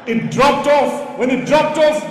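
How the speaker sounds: normal talking voice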